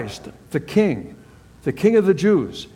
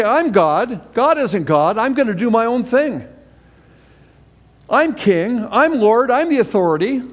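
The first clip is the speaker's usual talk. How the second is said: loud, raised-pitch mock defiance; defiant self-rule; 3 semitones higher, 3 dB louder than usual. Preacher voicing the rebel claiming kingship over their life, loud defiance. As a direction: act out a sinner insisting on being in charge. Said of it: "you exert your kingship. You say".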